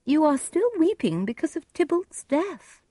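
high-pitched mother voice; reproving mother. Acted.

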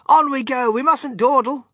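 high, loud chivvying call; urging on. A narrator's reading. Wonka leading visitors down the corridor, urging on. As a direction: hurry them along in a high voice. Wonka hurrying the party along.

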